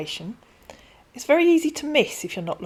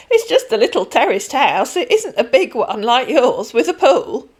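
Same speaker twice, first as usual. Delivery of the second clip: put-on chatty elderly lady, louder than the narration; a kindly pensioner rambling on; old lady Denise; 4 semitones higher, 8 dB louder than usual. Voices Denise.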